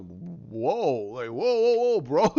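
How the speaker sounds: low whoa building to loud ones; mock alarm. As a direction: play stunned onlookers backing off. Acting out shocked onlookers.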